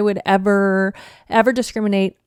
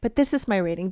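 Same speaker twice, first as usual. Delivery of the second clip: usual speaking voice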